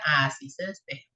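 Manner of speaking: low, confident, understated parent reply; knowing parent answer. Retold or imitated. Parent answering the teen's homework question, low and sure. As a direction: answer like a parent who knows it. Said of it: "cuando sus hijos ... les digan".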